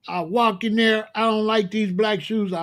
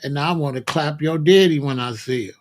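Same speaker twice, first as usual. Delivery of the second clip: ordinary talk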